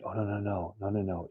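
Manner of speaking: hushed panicked thought. Retold or imitated. Alone and nauseous, he quietly dreads throwing up again, hushed. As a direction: murmur it to yourself in dread.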